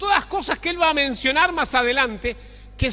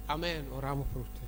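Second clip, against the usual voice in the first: routine reply; 11 semitones lower, 13 dB quieter than usual. Retold or imitated. Brethren's routine reply to prayer request.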